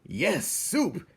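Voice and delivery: high, loud gleeful cheer; eager agreement. Acted. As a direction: cheer it on together. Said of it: "the others agreed".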